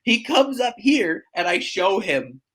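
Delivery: ordinary talk, nothing marked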